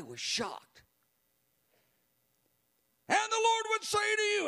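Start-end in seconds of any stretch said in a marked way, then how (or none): 3.1-4.5 much higher, fiery prophetic declaration; zealous prayer. Retold or imitated. Tiny elderly woman prophesying into the microphone with zeal. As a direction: proclaim it with fire. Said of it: "with a, a zeal and a energy".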